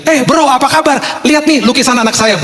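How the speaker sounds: high, eager greeting to a buddy; promoting eagerly. Retold or imitated. Father hails friends to promote his child's painting, high and eager. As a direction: pitch it up like a proud salesman. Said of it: "saya bilang gini".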